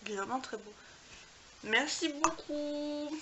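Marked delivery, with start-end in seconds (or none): 1.6-3.2 warm thanks. Real, not acted. Thanking the friend for gift.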